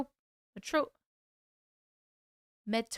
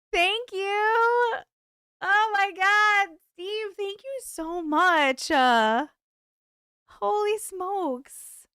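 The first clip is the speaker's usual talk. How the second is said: high, drawn-out gushing amazement; overwhelmed thanks; 9 semitones higher, 5 dB louder, much slower than usual. Real, not acted. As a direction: gush slowly in a much higher voice, astonished. Overwhelmed by a big gift.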